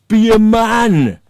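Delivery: very loud, high, drawn-out yell; imitated in-your-face yell. Man standing in his face as Dean stays silent, mimicked bellow. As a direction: bellow it slowly as a mocking imitation. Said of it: "standing there in my face going".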